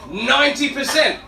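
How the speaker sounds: high-pitched outburst of disbelief; shocked, jumping up in pitch; incredulous exclamation. Acted. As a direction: repeat it as if you can't believe the figure. Exclaims at his own statistic.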